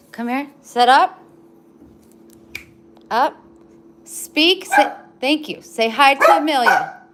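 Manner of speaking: loud, high commands to the dog; high pet commands. Real, not acted. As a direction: urge the dog to speak.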